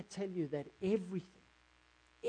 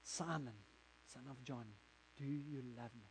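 much softer, calm questioning voice; Jesus asking gently; 4 semitones lower, 12 dB quieter than usual. Acted. Jesus asking Peter by the fire, soft and searching. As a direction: ask it quietly, as a tender question. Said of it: "Jesus turns to me and he says to me".

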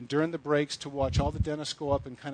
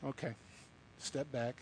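much softer, lower aside; backing off; 4 semitones lower, 15 dB quieter than usual. Real, not acted. Preacher retreats from provoking the ladies, backing off. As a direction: drop the volume right down after preaching.

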